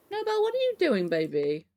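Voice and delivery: high sing-song voice to a pet rabbit; pet talk. Real, not acted. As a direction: coo it way up high at the bunny.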